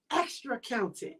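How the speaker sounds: normal talking voice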